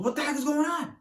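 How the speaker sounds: high, loud, startled teacher demanding answers; angry professor. Retold or imitated. Professor yelling at the class after the smack, high and loud. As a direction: bark it out like a shocked professor. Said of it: "And the professor goes".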